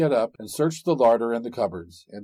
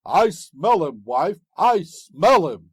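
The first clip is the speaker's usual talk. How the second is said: slow, loud giant growl; ogre sniffing; 5 semitones higher, 7 dB louder, slower than usual. A narrator's reading. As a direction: drag it out like a hungry ogre. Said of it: "cried out the ogre".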